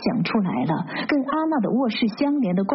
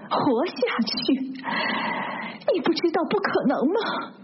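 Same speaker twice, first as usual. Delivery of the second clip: high, tearful protest building to a wail; desperate outburst; 10 semitones higher than usual. A narrator's reading. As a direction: climb from disbelief into a high despairing cry. Desperate retort in acted quarrel. (绝望地哭喊，越说越高)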